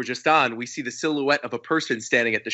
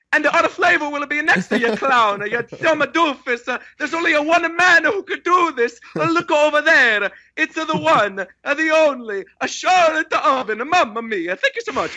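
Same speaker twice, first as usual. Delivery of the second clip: hammed-up Italian accent, much higher; 11 semitones higher, 3 dB louder than usual. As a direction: declaim it in a cartoonish, high Italian accent. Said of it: "Italian accent".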